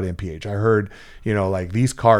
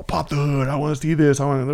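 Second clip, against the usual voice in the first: higher-pitched mock demand; demanding rival owner; 5 semitones higher than usual. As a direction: lightly mimic a pushy rival, pitched up. Acts out rival shop owner's demand.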